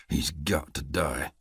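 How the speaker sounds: low, soft, slow murderous mutter. Acted. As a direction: mutter it under your breath. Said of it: "he muttered".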